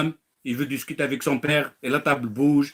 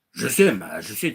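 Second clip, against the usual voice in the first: ordinary delivery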